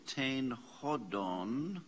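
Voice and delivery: ordinary delivery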